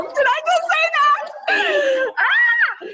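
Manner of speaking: high, loud, slow squeal of disbelief; giddy shriek at her own news; excited shout. Real, not acted. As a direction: shriek it with delight. Thrilled at her new Emerald rank.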